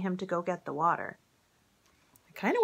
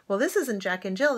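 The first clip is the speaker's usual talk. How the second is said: plain reading voice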